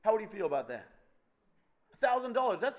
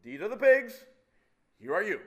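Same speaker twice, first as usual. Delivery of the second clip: loud, raised scolding from the pig owner; angry owner; 4 semitones higher, 7 dB louder than usual. Retold or imitated. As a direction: slap his hand away with your voice. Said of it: "and the owner says".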